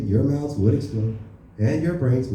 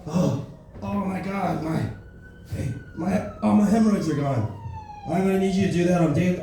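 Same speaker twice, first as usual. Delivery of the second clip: Trump impression; 4 semitones higher than usual. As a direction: do Trump, smug and rambling. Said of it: "here's Trump getting a prostate exam".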